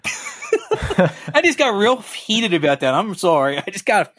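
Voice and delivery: slow, laughing apology. Real, not acted. Speaker apologizing to his co-host after ranting, laughing slowly.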